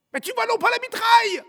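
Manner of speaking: loud, high-pitched incredulous yell; alarmed warning shout. Acted. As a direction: shout a warning across gunfire. Comrade shouts warning under fire.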